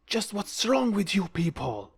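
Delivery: indignant shout. A narrator's reading. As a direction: shout it at them, appalled. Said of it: "one of them shout".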